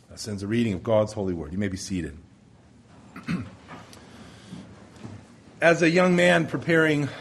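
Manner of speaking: usual speaking voice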